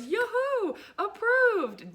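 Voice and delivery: high, loud sing-song cheer; cheerful yoo-hoo. Retold or imitated. Old lady cheering her card to the whole line, high and loud. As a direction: celebrate it like a giddy old lady.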